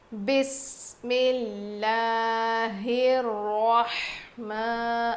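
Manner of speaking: ordinary talk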